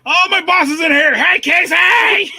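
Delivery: high, loud playful call; joking shout. Real, not acted. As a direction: shout it out to the boss.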